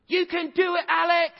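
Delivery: high, loud cheering from the stands; cheering mom. Retold or imitated. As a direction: shout it out like a proud mom at the match. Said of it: "hear this voice above all others".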